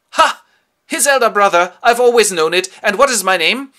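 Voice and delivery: loud, high triumphant exclamation; hammed-up high gleeful shout; mock triumph. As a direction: crow it out in triumph. Jack learns he's the elder brother.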